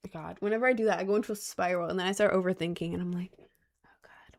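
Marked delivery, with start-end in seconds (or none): 3.4-4.4 barely audible self-conscious whisper; whispered inner thought. Retold or imitated. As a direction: whisper it like a private worry.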